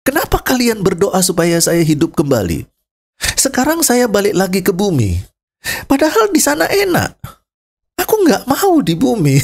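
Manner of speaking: high, indignant scolding; revived man scolding those who prayed, mock angry; mock angry complaint. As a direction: scold the praying family in mock anger. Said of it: "dia marah-marah".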